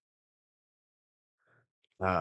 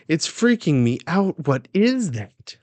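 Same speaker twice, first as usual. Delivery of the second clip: raised, louder alarm, slowing on the question; freaking out; 8 semitones higher, 6 dB louder than usual. Acted. Queen to attendants about her baby, freaking out. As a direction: play the panicked mother. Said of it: "she, like, freaks out".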